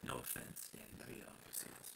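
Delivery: low, hushed throwaway apology; muttered aside. Real, not acted. Preacher quietly apologizing to Danny DeVito. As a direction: toss it off under your breath.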